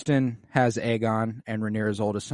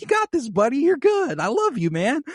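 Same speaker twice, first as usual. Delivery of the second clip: put-on high, bright encouragement for a kid; cheering father figure; 13 semitones higher, 3 dB louder than usual. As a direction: hype him up like a proud dad. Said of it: "and Harwin's like".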